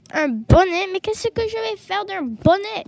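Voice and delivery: mocking fox, incredulous; sneering. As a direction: scoff loudly at the useless gift. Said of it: "se demanda Filou le renard en ricanant".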